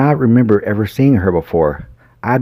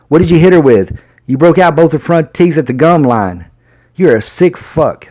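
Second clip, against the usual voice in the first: a hint of an enraged sheriff grilling the suspect; accusing sheriff; 7 semitones higher than usual. A narrator's reading. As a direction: hammer him with the questions. Sheriff interrogating angrily.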